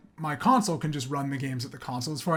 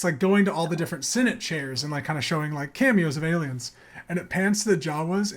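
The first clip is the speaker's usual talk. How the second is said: normal talking voice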